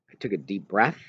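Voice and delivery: plain reading voice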